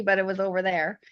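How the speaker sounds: normal talking voice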